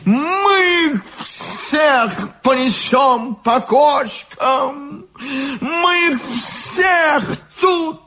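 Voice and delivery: very high, slow, tearful shouting; drunken bawling. A narrator's reading. Drunk guard boasting to his new friend, bawling. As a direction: wail the boast high and stretched.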